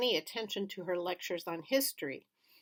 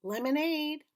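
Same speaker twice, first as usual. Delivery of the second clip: higher, called "Lemonade"; calling out; 9 semitones higher than usual. A narrator's reading. Old man offering drinks. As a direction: call it across the yard.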